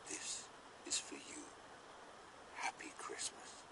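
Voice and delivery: soft whisper, gentle and hushed; secret whisper. Acted. Father Christmas hands over gift.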